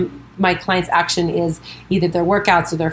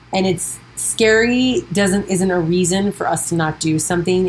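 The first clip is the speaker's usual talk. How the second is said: ordinary talk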